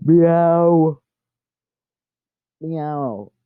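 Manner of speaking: imitated meow for a cat. Real, not acted. Calling her cat.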